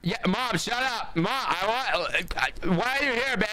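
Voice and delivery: put-on squeaky annoyed teenager; whiny teen voice. Mocking kid yelling at mom.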